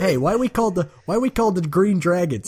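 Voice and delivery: high-pitched dopey gang member; dim bandit. Acted. Gang member questioning their name, dim bandit voice. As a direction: whine it up high, clueless and puzzled. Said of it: "low charisma, low intelligence name".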